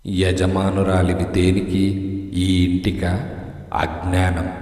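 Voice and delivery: ghostly, mocking. A narrator's reading. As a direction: drag it out low like a scornful ghost. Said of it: "అని మళ్ళీ స్పష్టంగా అన్నాడు".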